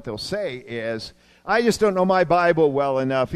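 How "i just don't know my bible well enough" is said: louder voice imitating a churchgoer's excuse; emphatic quoted excuse. Churchgoer's excuse to pastor as retold, emphatic. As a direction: push out the mimicked complaint loudly.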